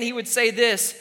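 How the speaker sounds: ordinary talk, nothing marked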